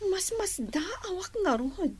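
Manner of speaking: whisper. Acted. Young woman sharing a suspicion with the maid, whispered. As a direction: lean in and whisper it.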